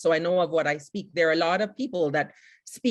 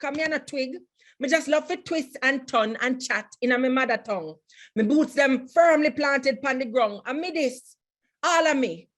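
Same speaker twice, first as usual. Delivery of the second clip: higher, lively Jamaican Patois poem recital; proud poem in Jamaican Patois, higher pitched; reading in Patois; 6 semitones higher than usual. Shown as a demonstration. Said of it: "I'm gonna read it in Patois, my mother tongue".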